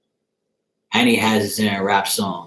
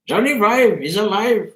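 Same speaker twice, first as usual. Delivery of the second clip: much higher mocking Indian-accent voice, Short Circuit style; 10 semitones higher than usual. Mocking a streamer in his chat with a put-on Indian accent. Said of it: "And I mocked him that day".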